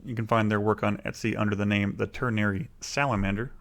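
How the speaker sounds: normal talking voice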